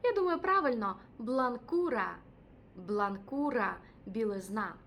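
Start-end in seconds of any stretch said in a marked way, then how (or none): 1.2-2.2 Бланкура said slowly, every syllable clear; Бланкура drawn out like a teacher; slow teaching demo. Shown as a demonstration. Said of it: "Наступне". 2.8-3.8 Бланкура said slowly, every syllable clear; Бланкура drawn out like a teacher; slow teaching demo. Shown as a demonstration. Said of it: "Наступне".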